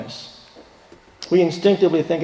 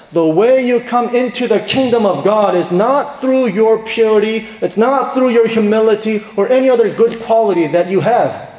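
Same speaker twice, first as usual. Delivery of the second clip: raised, driving sermon voice; full-force preaching; 5 semitones higher, 5 dB louder than usual. Acted. Pastor preaching salvation to his church, raised and driving. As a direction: press the point home to the congregation.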